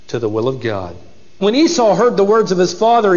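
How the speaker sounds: ordinary talk, nothing marked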